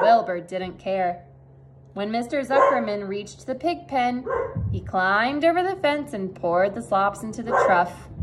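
plain reading voice

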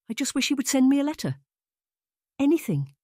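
high, fast yearning plea; longing plea. Acted. Plea to her friend about her absent sweetheart, high and fast. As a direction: Diana begging for any word from him. Diana pleading for a letter.

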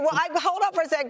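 high, quick burst cutting in; excited interruption. Real, not acted. Host cuts in with excitement, fast and high. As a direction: jump in eagerly to stop him.

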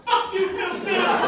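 high, loud curse after being ignored; bitter mock yell. Rejected fan yelling at Phil Simms, mock angry. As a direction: spit it up at the booth, spurned.

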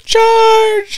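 very high, loud yell; battle cry. Pretend. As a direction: shout it like leading a charge.

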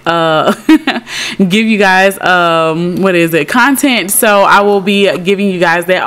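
ordinary talk, nothing marked

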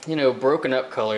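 ordinary talk

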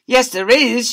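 high, loud cheeky shout; joker shouting. Acted. Joker heckling the teacher, loud cheeky shout. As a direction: heckle from the back. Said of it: "shouted a joker".

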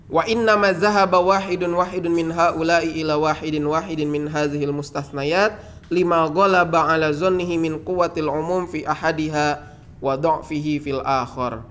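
usual speaking voice

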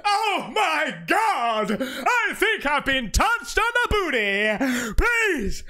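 high, slow hammed-up shriek; mock shocked actress. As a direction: overact the fright like bad acting. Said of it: "she's gonna be like".

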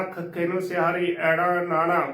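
ordinary delivery

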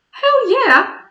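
high, loud gleeful yell; excited shout. Real, not acted. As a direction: shout it with delight. Enthusiastic answer about her cat.

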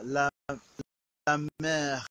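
la mère stretched very slowly; slow French la mère. Shown as a demonstration. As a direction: draw out la mère. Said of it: "if that is la mère".

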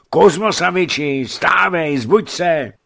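high, loud pleading to get up; urgent waking shout. A narrator's reading. Messenger waking the sleeping merchant, urgent shouting. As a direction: rouse a heavy sleeper with a shout. Messenger tries harder to wake master.

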